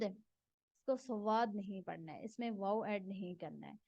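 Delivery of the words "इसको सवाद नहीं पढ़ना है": ordinary talk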